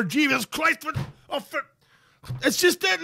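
ordinary talk, nothing marked

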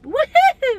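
high whoop; high, elated woohoo; triumphant cheer. Real, not acted. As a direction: whoop with delight. Celebrating after the call.

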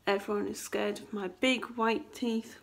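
ordinary delivery

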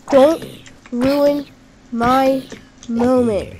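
"Don't" "ruin" "my" "moment" each shouted loud and apart; word by word. Real, not acted. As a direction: hammer each word separately. Repeats word by word.

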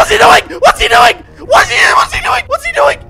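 very high, loud shrieked pleading; frantic screaming and begging; hysterical panic. Real, not acted. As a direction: shriek as if being chased down. Attacked by mob.

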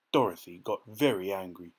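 ordinary delivery

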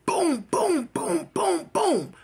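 high, punchy "boom" gunfire noises; imitated gunshots. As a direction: mimic a volley of shots, high-pitched. Imitates gunshots hitting the house.

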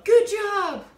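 high, loud shout of encouragement; cheering yell. Retold or imitated. Crew yelling to skipper mid-race, loud cheering shout. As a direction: call it out across the boat to cheer her on. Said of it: "she would yell".

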